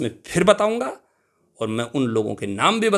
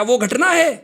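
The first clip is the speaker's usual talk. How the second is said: घटना said much higher and louder with a sharp fall; emphatic definition; 11 semitones higher, 6 dB louder than usual. Shown as a demonstration. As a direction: punch घटना high like a teacher's cue. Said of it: "teacher drives home definition". (घटना पर ऊँचा ज़ोर)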